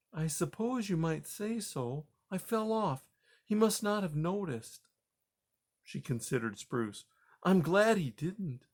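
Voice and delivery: puzzled but kind; gentle grandma mouse. Acted. Grandma Poppy.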